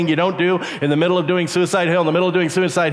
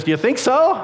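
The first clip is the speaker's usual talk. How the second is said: higher-pitched, rising eager question; lightly voiced gullible friend; 8 semitones higher than usual. Flattered friend asking about the jump, lightly mimicked eager question. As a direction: play the friend who falls for it.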